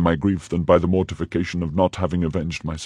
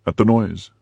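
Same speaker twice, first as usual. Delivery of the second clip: plain reading voice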